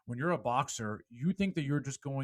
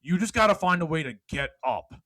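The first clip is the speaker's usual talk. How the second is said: loud, high motivational speech; fired-up preaching; 4 semitones higher, 4 dB louder than usual. Acted. Speaker rallying podcast listeners, loud preaching. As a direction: preach it to the whole room.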